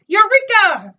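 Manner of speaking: high, loud triumphant cry; shouted. A narrator's reading. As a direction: burst out with the discovery. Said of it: "he shouted".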